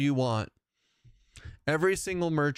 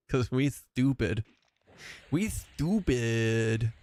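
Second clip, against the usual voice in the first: dopey voice; much slower than usual. Pretend. Dopey self-mocking voice.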